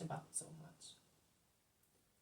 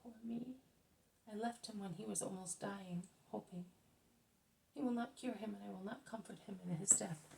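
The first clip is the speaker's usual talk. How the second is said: higher, softer tearful lament; weeping woman; 4 semitones higher, 6 dB quieter than usual. A narrator's reading. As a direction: plead through tears, lightly voiced. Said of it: "The woman begins to weep".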